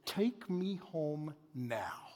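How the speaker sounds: a wife ending the argument quietly and slowly; cold command. Retold or imitated. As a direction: say it with quiet finality. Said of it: "she says".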